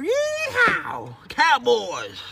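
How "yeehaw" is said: high whooping yeehaw; cowboy yeehaw. Acted. Texan cowboy cheering, a high whooping yeehaw.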